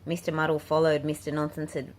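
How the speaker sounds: plain reading voice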